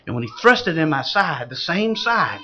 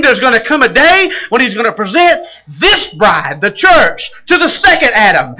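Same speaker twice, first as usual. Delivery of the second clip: louder, higher, ringing revival climax; full-force preaching; 5 semitones higher, 9 dB louder than usual. Real, not acted. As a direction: lift the church up with it.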